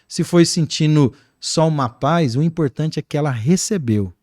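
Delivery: usual speaking voice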